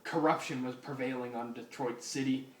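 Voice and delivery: usual speaking voice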